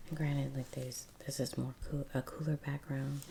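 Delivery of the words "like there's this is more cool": normal talking voice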